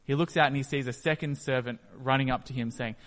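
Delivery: normal talking voice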